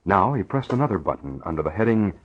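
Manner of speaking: ordinary talk, nothing marked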